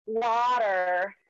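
drawn-out American "water"; American twang. Shown as a demonstration. Showing the host her American accent, American twang. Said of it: "say it in an American twang".

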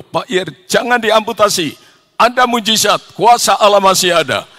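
rally him with fervent faith; impassioned exhortation. Retold or imitated. Friend urging prayer against amputation.

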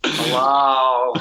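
put-on drawn-out character accent; Janine from Ghostbusters. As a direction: greet like a comic receptionist. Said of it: "Janine from Ghostbusters".

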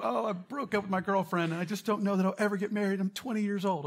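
high, fast melodramatic lament; mock melodrama. Pastor mocking a twenty-year-old's breakup, high and fast. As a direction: ham up a young heartbreak.

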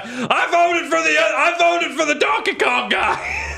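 hammed-up shout. Hammed-up vote announcement.

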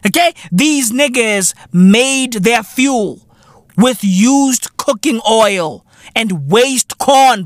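ordinary delivery

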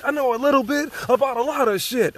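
very high put-on character voice; [name]. Podcaster turns his own line into a catchphrase, high put-on voice. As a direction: deliver the boast in a silly high-pitched voice. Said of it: "My name is [name]".

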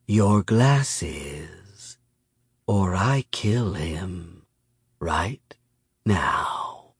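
slow, quiet purred threat; Miyamune purring. A narrator's reading. Predator threatens to kill a hostage, purring menace. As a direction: draw it out with pauses before the last words. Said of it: "Miyamune purred".

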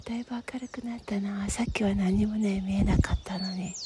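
hushed wonder; whispering. Real, not acted.

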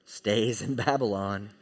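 hushed, low tempter's voice; soft, low mock whisper; whispering Satan. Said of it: "Satan all the while is whispering to them".

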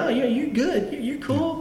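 high-pitched imitated reassuring; mock reassurance. Voices singer reassuring the woman.

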